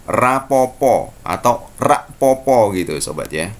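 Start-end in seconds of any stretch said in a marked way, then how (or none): 0.1-1.2 slow, drawn-out slang "rapopo"; slang Javanese. Shown as a demonstration. Teacher showing students the shortened Javanese form. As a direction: stretch "rapopo" slowly, the casual way. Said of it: "berubah lagi menjadi rak popo".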